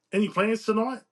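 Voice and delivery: lifted, chirpy character question; hopeful friend. A narrator's reading. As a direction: fish eagerly for an invitation. Eager friend asks in story.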